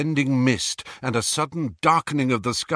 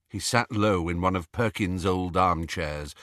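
ordinary delivery, nothing marked

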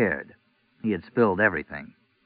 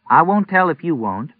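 firm, higher, brooking no argument; wife firmly settling it with her husband; 8 semitones higher than usual. A narrator's reading. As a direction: set your foot down, a touch playful. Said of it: "she said firmly".

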